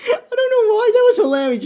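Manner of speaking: high, loud through real laughter; laughing hard. Real, not acted. Vlogger cracking up at the reunion scene, real laughter. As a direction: crack up mid-sentence.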